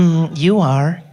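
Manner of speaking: seductive purring reply; flirty Siri. Retold or imitated. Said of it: "She'll be".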